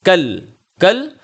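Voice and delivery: کل said slowly with the lam held and stopped, no vowel after it; slow stopped kul. Shown as a demonstration. Teacher models کل for sister with a stopped lam. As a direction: clip کل short, no la li lu. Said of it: "لام کو absorb کر رہے ہو".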